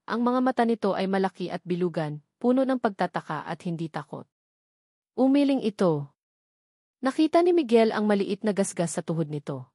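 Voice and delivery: ordinary delivery, nothing marked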